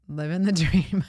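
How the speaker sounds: wry line breaking into a laugh. Real, not acted. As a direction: let a real laugh spill into "dream". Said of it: "I'm laughing only because".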